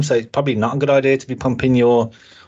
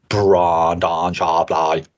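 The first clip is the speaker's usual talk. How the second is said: slow, low host-style name call; imitating Alan; 6 semitones lower, much slower than usual. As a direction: announce the name slowly like Alan. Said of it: "That's how Alan would say it".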